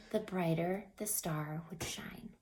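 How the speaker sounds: soft closing whisper. A narrator's reading. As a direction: let it fade into a whisper. Quiet closing line.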